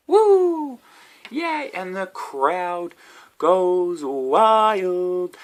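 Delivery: high, far-off whooping crowd; imaginary cheering crowd. Pretend. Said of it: "That's my imaginary crowd there".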